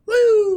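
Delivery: high, loud "woo" of a flying log; high cartoon launch noise; imitated whoosh.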